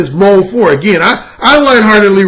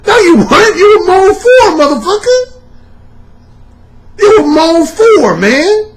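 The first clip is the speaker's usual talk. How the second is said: indignant shout; 13 semitones higher, 3 dB louder than usual. Real, not acted. Calling out imitators.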